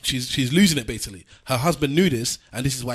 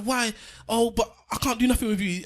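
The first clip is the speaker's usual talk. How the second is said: put-on old actress; 9 semitones higher than usual. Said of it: "She's like".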